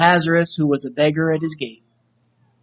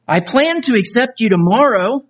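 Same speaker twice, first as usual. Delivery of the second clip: higher, pleading plea with a touch of tears; crying; 6 semitones higher than usual. Acted. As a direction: beg through mild tears. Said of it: "crying to him".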